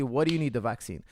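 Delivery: ordinary talk, nothing marked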